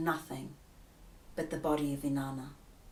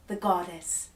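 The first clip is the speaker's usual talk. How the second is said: usual speaking voice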